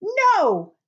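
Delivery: shouted high and loud; an angry child's loud NO. Acted. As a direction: Sophie yelling no. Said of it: "Sophie said".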